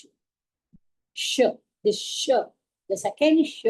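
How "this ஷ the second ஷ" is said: normal talking voice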